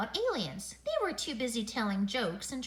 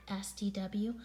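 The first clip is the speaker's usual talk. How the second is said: plain reading voice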